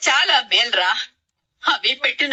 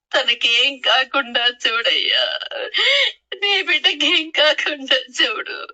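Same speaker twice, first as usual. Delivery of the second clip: weeping lament with sobs between phrases; crying, halting prayer for help; tearful pleading. Acted. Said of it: "ఎందుకు ఏడుస్తున్నావమ్మా?".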